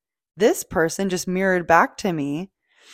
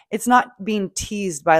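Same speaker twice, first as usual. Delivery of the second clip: ordinary talk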